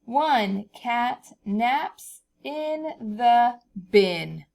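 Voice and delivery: very slow, word by word; slow reading together. Shown as a demonstration. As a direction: read it slowly all together. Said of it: "All right, all together".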